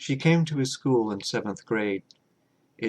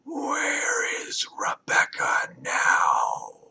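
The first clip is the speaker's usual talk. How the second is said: slow, hollow unvoiced whisper; eerie whisper; 4 semitones lower, much slower than usual. A narrator's reading. Hive mind whispering to Jacob. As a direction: whisper it like a voice inside his head.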